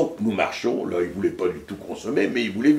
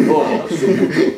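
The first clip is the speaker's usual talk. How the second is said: normal talking voice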